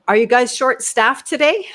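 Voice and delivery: high, loud public query; kinda loud question. Retold or imitated. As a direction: ask it loudly so the whole room hears. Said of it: "I said it kinda loud".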